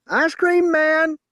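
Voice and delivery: very high, loud, slow twangy holler; drawn-out call. A narrator's reading. Blind woman announcing the truck, slow loud holler. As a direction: stretch it out as a holler. Woman calling Sam again.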